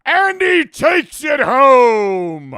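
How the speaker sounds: loud, high, slow announcer call; mock sports announcer. Imitating how NFL commentators call a score. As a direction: belt it like a TV play-by-play man. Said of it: "they'd be like".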